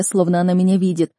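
plain reading voice

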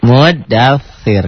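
muddaththir slowly, tongue tip at the teeth on dh; slow muddaththir with dh between the teeth. Shown as a demonstration. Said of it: "kayak orang cadel".

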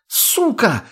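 very high, angry cry; enraged roar. A narrator's reading. Tonya falls as she shoots at the addict, enraged cry. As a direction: shriek the curse without extra volume. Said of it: "взревела девушка".

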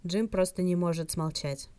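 usual speaking voice

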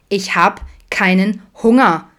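put-on loud, slow, emphatic teen sulk; stubborn, drawn-out complaint; grumpy whining. A narrator's reading. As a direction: grouse it word by word. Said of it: "motzte Eileen".

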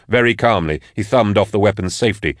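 plain reading voice

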